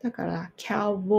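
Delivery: ordinary delivery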